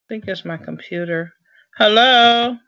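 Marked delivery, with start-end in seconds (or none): 1.8-2.6 calling out. Real, not acted. Calling into a dead call.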